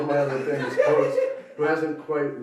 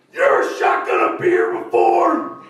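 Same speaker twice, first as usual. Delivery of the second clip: much higher, louder party yell; hammed-up shout; 11 dB louder than usual. Comic yelling at the audience, loud high shout. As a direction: bellow it at the crowd. Shouting catchphrase bit to crowd.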